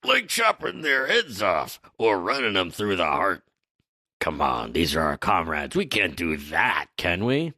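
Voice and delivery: Dory. Acted. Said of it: "Dory says".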